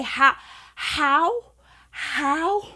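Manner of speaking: high, baffled "how" again and again; can't believe the coincidence, pitch climbing; disbelief. Real, not acted. Asking viewers how this could happen, high disbelief.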